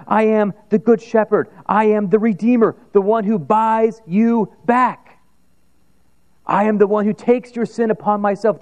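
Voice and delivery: preaching at full force. Acted. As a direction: preach it out, rising with every phrase. Pastor preaching to congregation.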